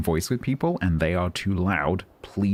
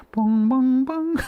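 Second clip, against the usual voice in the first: high sung bong chime; sung doorbell chime; 5 dB louder, much slower than usual. Retold or imitated.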